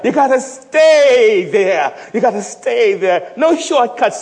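loud, high call and response; full-force preaching. Acted. Preacher urging fathers to stay there, loud. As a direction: drive the refrain home.

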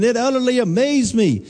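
ordinary talk, nothing marked

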